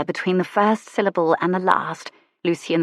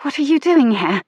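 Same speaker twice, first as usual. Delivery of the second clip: higher, louder startled lady; shocked lady; 8 semitones higher, 5 dB louder than usual. Acted. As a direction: demand an answer in alarm. Lady confronts Lucian.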